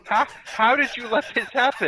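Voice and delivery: high, loud and laughing; mock horror. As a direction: demand answers in comic dismay. Joins in as horrified commander, laughing.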